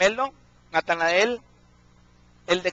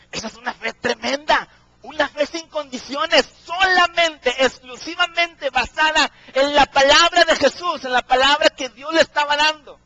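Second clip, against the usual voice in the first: raised, loud and high sermon delivery; fervent pulpit preaching, pitch and volume lifted; 6 semitones higher, 5 dB louder than usual. Acted. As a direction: preach it out to the congregation at full force. Preaching at full force.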